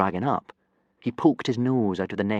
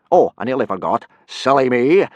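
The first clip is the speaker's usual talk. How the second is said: careless; 4 semitones higher, 9 dB louder than usual. Acted. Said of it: "Gobber laughed carelessly".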